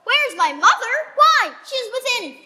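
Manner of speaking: a daughter irritated by her nurse's question; exasperated. Acted. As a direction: throw the question back in disbelief.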